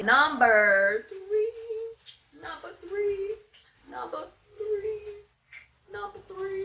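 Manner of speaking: high, wailing mock crying title; dramatic title call. Vlogger hamming up the next tip title as a mock wail. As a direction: wail the section number like a sob.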